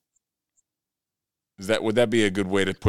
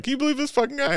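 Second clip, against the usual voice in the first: much higher-pitched mock disbelief; imitated disbelief, pitched way up; incredulous aside; much faster than usual. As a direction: retell the aside in a high, incredulous voice. Same incredulous aside, retold.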